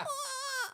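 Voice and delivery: high, drawn-out mock pain cry; exaggerated whimpering "oh"; imitated whimper. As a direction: wail it high, half laughing. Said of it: "I was whimpering".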